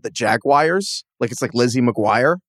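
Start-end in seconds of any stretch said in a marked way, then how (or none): 0.0-1.0 "Jaguars" drawn out slowly as "JAG-wires", rising at the end; Craig's pronunciation. Retold or imitated. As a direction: imitate Craig saying "Jaguars", slow and stretched. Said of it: "pronounce Jaguars like how Craig does it".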